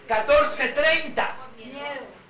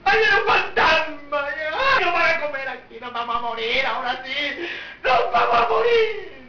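mock panic. Disciples crying out at the ghost, mock panic. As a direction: wail in exaggerated dread.

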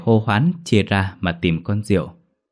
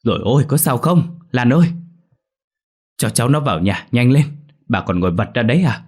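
loud, urgent, higher calls; calling out; 4 semitones higher, 4 dB louder than usual. Acted. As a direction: hurry everyone, louder. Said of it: "hớt hải kêu lớn".

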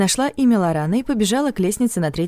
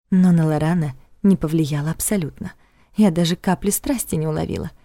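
ordinary delivery

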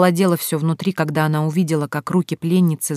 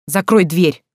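louder, curt command; barked order; 5 dB louder, slower than usual. A narrator's reading. Husband ordering his wife to shut it, barking. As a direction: bark it as an urgent order. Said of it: "рявкнул Сергей".

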